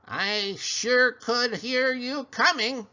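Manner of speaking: slow, cackling creature voice. Acted. Small creature greets the pig in a slow cackle. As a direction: croak like a little witch. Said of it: "she cackled".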